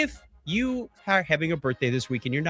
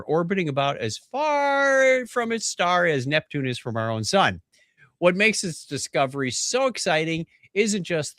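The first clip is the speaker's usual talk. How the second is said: emphatic headline delivery; news read; 3 semitones higher, slower than usual. Real, not acted. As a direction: read the science story with big emphasis. Reads news story aloud.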